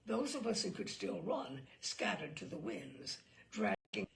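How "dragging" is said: plain reading voice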